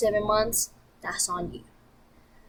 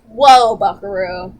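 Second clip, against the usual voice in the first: a loud, slow mock whoa then settling; imitating the audience; 3 semitones lower, 8 dB louder, slower than usual. Viewers telling the vlogger to calm down, mock loud whoa. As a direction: rein in a hothead, mockingly. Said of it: "a lot of you are like".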